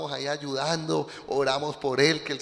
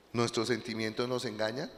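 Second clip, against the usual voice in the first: ordinary delivery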